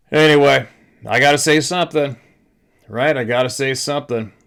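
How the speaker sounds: loud declaration. Real, not acted. Ranting, rising to a declaration.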